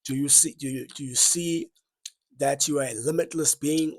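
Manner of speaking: usual speaking voice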